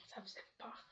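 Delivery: chuchotement bas et mystérieux; souffle-le pour faire frissonner; chuchoté. A narrator's reading. Le conteur insiste sur la peur. (chuchote « ça faisait peur » pour effrayer)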